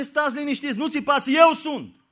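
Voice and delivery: loud, high call over the waves; calling over storm. Acted. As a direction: shout it over the wind. Jesus calls over the storm. (strigăt tare peste furtună)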